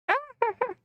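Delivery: very high squealing laugh; short squeaky burst of laughter; high-pitched cackle. Real, not acted. Laughs at own pun.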